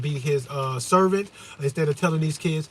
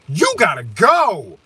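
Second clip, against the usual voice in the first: cold verdict; 5 dB louder, much slower than usual. Pretend. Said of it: "look in their eyes and go".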